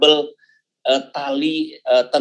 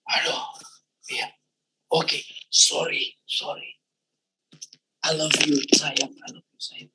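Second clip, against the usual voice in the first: soft apology; 2 semitones lower, 8 dB quieter than usual. Retold or imitated. Apologizing to wife after outburst.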